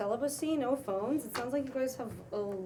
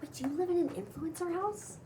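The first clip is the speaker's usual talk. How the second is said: barely contained excitement; 6 semitones higher than usual. Acted. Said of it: "She can't contain her excitement".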